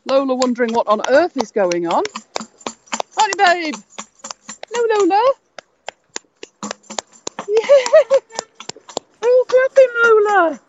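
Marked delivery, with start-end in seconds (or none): 3.2-7.8 pet voice. Real, not acted. Talking to her dog. 9.2-10.6 pet voice. Real, not acted. Praising the dog.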